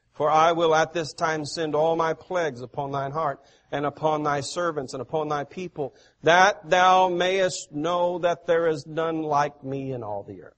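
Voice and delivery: loud, declaiming preacher voice, easing off at the end; forceful scripture reading. Acted. Preacher reading God's words to the congregation, loud and declaiming. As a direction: proclaim God's words from the pulpit. Reads the plagues verse aloud.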